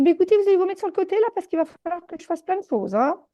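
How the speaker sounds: fast, high, brisk directions; hurried instruction. Retold or imitated. Healer briskly moving clients aside, fast and high. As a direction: rush them out of the way.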